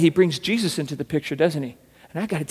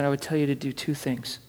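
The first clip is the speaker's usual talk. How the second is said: ordinary talk, nothing marked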